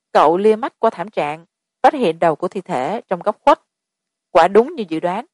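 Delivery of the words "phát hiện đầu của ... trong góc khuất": plain reading voice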